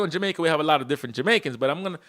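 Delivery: ordinary delivery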